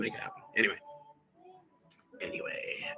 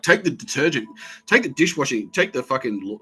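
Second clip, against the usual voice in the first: angry rant; 5 semitones higher, 6 dB louder than usual. Retold or imitated. Ranting about stolen detergent.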